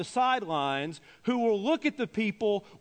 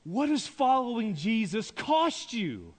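raised pitch, leaning hard on "cost"; forceful preaching question; 3 semitones higher, slower than usual. Real, not acted. Preacher repeats his challenge to congregation, forcefully. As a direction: drive the question home to the room.

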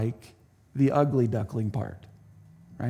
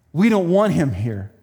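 mean ducks; 3 semitones higher, 6 dB louder than usual. Acted. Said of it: "they said".

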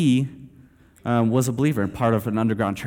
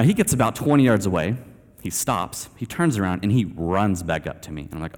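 ordinary talk, nothing marked

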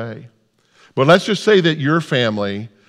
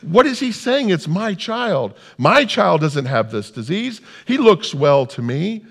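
bewildered wondering; 9 semitones higher than usual. Acted. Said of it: "you start to wonder".

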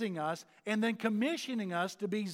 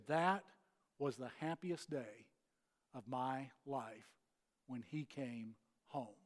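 low, hushed and measured; tender retold quote; 8 semitones lower, 10 dB quieter than usual. Preacher retelling a woman's joy at his homecoming, hushed and tender. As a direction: quote her softly and low, letting each phrase settle. Quoting woman's happiest day.

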